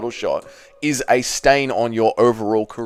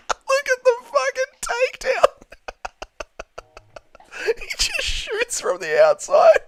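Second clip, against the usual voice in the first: laughing in disbelief. Real, not acted. Laughing at takedown.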